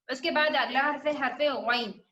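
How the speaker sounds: ordinary talk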